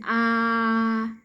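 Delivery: "Ah" held long, level and strong; voiced ah. Shown as a demonstration. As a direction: sustain a fully voiced "Ah". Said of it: "You need voicing".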